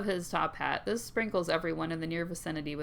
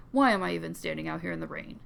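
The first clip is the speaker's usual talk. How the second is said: ordinary talk, nothing marked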